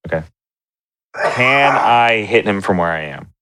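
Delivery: ordinary talk, nothing marked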